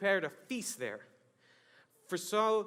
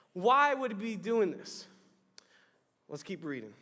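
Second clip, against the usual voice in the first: normal talking voice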